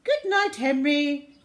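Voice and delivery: aunt bidding goodnight brightly. Acted. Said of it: "said Ruby".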